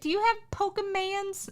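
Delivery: high, mocking customer voice asking for Pokemans; customer impression. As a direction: squeak it like a clueless customer. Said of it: "That used to happen all the time when I worked at GameStop".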